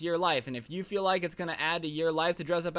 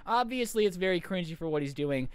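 normal talking voice

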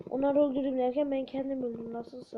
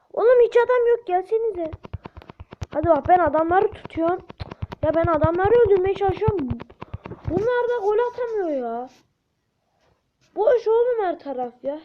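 higher and louder, exasperated shouting; frustrated yelling; 4 semitones higher, 7 dB louder than usual. Real, not acted. Gamer shouting at teammates who cannot score, loud and exasperated. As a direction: yell at the team that the goal is open.

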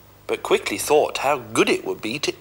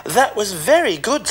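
much higher, bright cat voice; Puss the cat; 11 semitones higher, 3 dB louder than usual. Acted. Puss baiting the ogre, high coaxing voice. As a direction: coax the ogre along.

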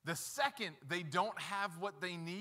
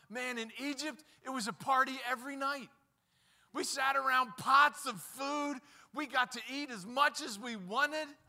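higher and louder, hammed-up nostalgic whine; mock the grumblers' rosy memories, pitched up; mocking whiny Israelites; 7 semitones higher, 5 dB louder than usual. Preacher mimics Israelites longing for Egypt, hammed-up higher whine.

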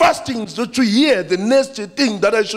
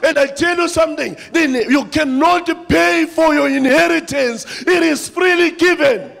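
preaching at full force; 6 semitones higher than usual. Acted. Preacher rallies the church at full force. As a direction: build the church up to a roar.

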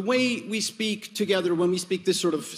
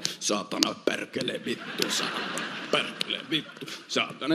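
low, muttered Finnish curses, softer; Finnish swearing; 7 semitones lower, 7 dB quieter than usual. Retold or imitated. Friends cursing at mosquitoes in Lapland, low muttered Finnish swearing. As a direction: grumble the curses under breath. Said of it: "my friends were always going like".

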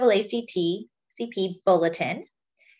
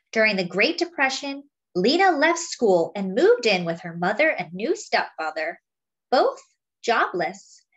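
put-on lively picture-book read to children; story narration; 4 semitones higher than usual. A narrator's reading. Reading a Lena Horne picture book to friends, lively storytelling. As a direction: tell it like a storyteller to a class.

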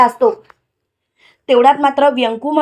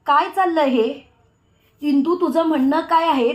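ordinary delivery, nothing marked

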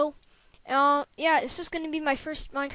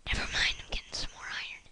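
whisper; 6 dB quieter, faster than usual. Real, not acted. Whispered aside.

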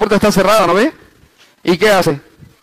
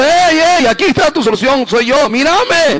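the Lord calling; 9 semitones higher than usual. Retold or imitated. Pastor voicing the Lord calling.

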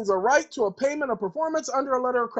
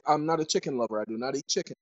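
ordinary talk

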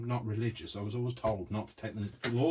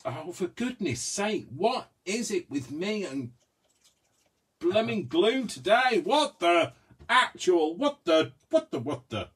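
exasperated outburst; 9 semitones higher, 7 dB louder than usual. Real, not acted. Frustrated with glue again.

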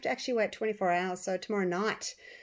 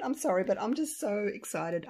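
ordinary talk

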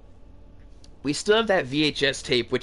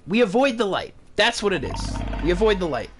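rattled; 7 semitones higher than usual. Real, not acted. Rattled after scare, still high.

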